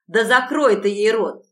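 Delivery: loud angry yelled order; angry shout. A narrator's reading. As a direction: yell it at the accomplice.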